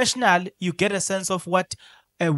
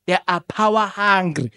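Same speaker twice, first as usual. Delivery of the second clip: ordinary talk, nothing marked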